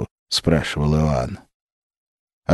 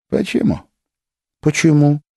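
frightened; 10 semitones higher, 3 dB louder than usual. A narrator's reading. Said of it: "испуганно повторила Маша".

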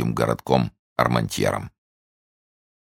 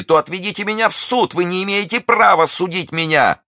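shrieking, very high and loud; wild scream; 3 dB louder than usual. A narrator's reading. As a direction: demand a trial in a frenzy. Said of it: "дико закричала миледи". (дикий отчаянный крик)

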